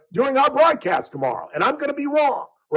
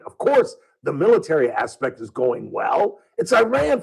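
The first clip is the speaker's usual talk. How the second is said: ordinary talk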